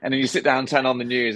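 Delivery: ordinary delivery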